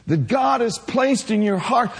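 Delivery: ordinary talk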